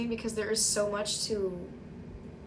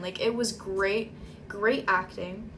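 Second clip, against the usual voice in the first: ordinary delivery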